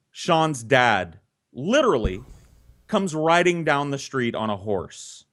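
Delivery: ordinary talk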